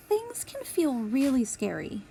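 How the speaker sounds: usual speaking voice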